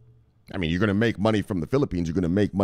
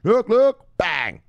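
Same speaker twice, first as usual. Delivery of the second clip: hype catchphrase; 10 semitones higher, 5 dB louder, much slower than usual. Acted. Catchphrase shout.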